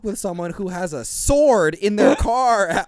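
high, loud, laughing mock agreement; laughing sarcasm. Laughing sarcasm about the sword.

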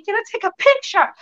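put-on high-pitched British teen accent; imitated British accent. Actress mimicking London teens asking for a picture, British accent. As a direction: mimic British teenagers, non-rhotic "picture". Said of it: "Com o sotaque deles".

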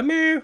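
mock kitten meow. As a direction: squeak it like a begging kitten. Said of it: "Reska siellä". (matkittu korkea kissan naukaisu)

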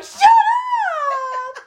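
high tearful shout; crying shout. Retold or imitated. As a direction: yell it through tears. Crying reply to friends.

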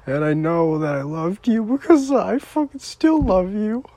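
high, sobbing declaration of love; real crying. Real, not acted. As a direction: break into sobs as you say it. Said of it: "I'm not supposed to be crying".

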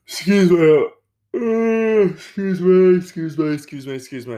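talking right through a big yawn; high, stretched yawny voice trailing down. Real, not acted. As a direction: apologise repeatedly mid-yawn. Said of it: "put up with the yawning".

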